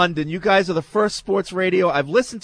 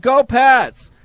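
high, loud mock cheer; retold yell; 8 semitones higher, 7 dB louder than usual. Old lady answers the waitress with her own cheer, yelled. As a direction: imitate someone yelling the punchline. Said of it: "and yelled out".

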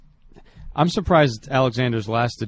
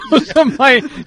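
"Yosemite" said high through laughter; laughing correction; 13 semitones higher, 5 dB louder, much slower than usual. Real, not acted. Laughing at the mispronunciation.

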